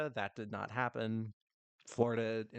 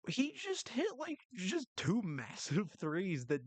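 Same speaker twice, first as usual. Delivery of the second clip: excited; 10 semitones higher, slower than usual. Real, not acted. Excited about two big threes.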